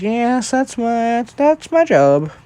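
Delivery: high-pitched, drawn-out character voice; Recovery Girl. Acted. Healer answers a patient's praise, in a high put-on voice.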